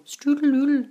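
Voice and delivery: playful made-up word; playful sing-song. Pretend. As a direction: sing strudel-oodle like a silly jingle. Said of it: "Why did you say strudel-oodle".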